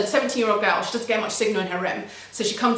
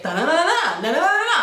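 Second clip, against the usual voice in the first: swooping sing-song mock rant; imitated ranting noise; 8 semitones higher, 3 dB louder than usual. What the speaker heard from upstairs, mock ranting noise. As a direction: mimic distant angry babble without words. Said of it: "Next thing I hear is".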